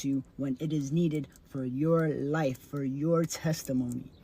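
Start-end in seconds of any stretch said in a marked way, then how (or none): none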